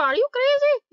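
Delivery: high-pitched incredulous cry; mock outrage. Telling off toxic Anna, high mock outrage. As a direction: shoot up high in disbelief.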